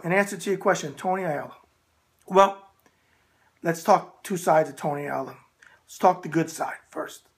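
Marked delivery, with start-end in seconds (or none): none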